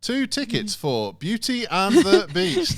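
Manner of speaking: high, loud, slow robot booking voice; automated phone voice. Retold or imitated. Cinema booking line getting the order wrong, robotic. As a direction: read it like a mechanical recorded line. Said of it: "And then they go".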